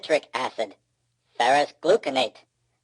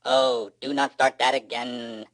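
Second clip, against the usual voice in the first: robotic moan. A narrator's reading. Clink complains to the other robot, moaning mechanically. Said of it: "moans Clink".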